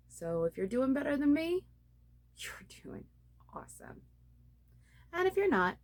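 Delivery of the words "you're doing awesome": hushed aside; whisper. Real, not acted. Vlogger telling better viewers they're doing awesome, whispered. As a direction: whisper it like a secret compliment.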